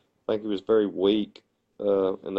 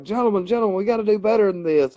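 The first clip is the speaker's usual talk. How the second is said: high-pitched mock plea for order; mock moderator; 9 semitones higher than usual. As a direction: play the flustered moderator, pitched up. Imitating moderator calming the debate.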